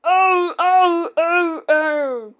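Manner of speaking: high wailing oh, oh; hammed-up toy-truck wail; mock crying. A narrator's reading. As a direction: cry out in a childish wail. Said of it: "they cried again".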